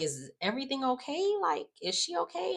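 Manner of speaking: high-pitched, rising worried question; imitated concern. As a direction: ask it high, mimicking concern.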